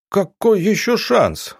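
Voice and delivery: slow, high, put-on indignation; fake outrage. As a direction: pretend to be outraged. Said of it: "фальшиво возмутился Петраков".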